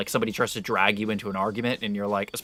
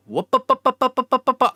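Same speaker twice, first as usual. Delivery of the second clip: high, loud imitation of a car that won't start; rising up, up, up like a dying engine; car sputtering noise; 14 semitones higher, 8 dB louder than usual. Telling the stream about her stalled car, doing a loud high sputtering engine. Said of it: "and it's like".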